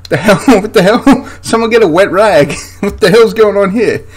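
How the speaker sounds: what on earth am I looking at; incredulous outburst. Real, not acted. Reacting to a bleeding drummer in the film, incredulous and laughing.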